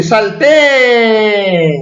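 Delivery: "salté" cried out with a long high "té"; exclamation. Shown as a demonstration. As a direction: exclaim "salté", stretching and raising the end. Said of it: "que indican admiración. Como dice".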